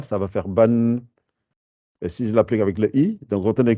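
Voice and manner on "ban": "ban" with a clear final n; ban with nasal n. Shown as a demonstration. Teacher showing the n ending, "ban" with its n. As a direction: add a crisp n to "ban". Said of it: "je l'applique avec le ba".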